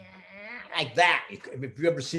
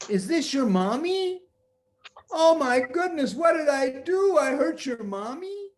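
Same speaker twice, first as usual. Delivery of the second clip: put-on high, upset young boy; a lightly voiced fretful boy; worried boy voice; 10 semitones higher than usual. Peter's voice in story.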